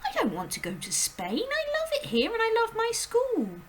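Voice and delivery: put-on high, urgent little girl's voice; a startled child calling out; high girl's cry; Matilda crying out. A narrator's reading. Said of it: "Matilda cried".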